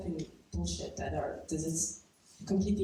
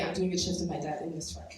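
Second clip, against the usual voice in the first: ordinary talk, nothing marked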